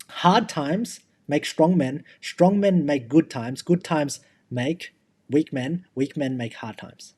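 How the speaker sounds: very slow, each phrase laid out deliberately; slow quote recital. Retold or imitated. Quoting a proverb to his podcast co-host, slow and deliberate. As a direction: recite the proverb slowly, like a saying worth remembering. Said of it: "There was a quote and it said".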